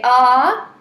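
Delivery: loud held "Ah" demo; sustained ah. Shown as a demonstration. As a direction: hold a long loud ah.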